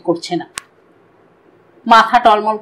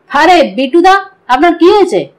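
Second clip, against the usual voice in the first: startled question, higher and a little louder; surprised; 6 semitones higher, 4 dB louder than usual. A narrator's reading. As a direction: ask it in raised-pitch astonishment. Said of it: "Montu অবাক হয়ে বলল". (অবাক হয়ে, চড়া গলায়)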